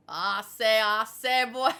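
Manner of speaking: loud mock Texan stammered catchphrase; hammed-up Texan voice, testing the catchphrase; rough Texas accent. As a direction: boom out a put-on Texas accent. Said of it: "don't know what accent he has".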